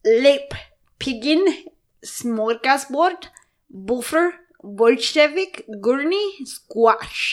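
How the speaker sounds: slow, word-by-word mock Spanish accent; hammed-up Spanish accent, pausing between words. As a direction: read the list in a deliberate Spanish accent. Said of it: "Now read it in a Spanish accent".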